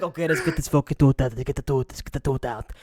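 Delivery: low, grumbling dentist impression; mock gruff, low-pitched dentist; miserable dentist. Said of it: "my dentist is like".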